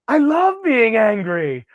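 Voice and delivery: a lightly voiced cartoon Ren, relishing it. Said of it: "he's like".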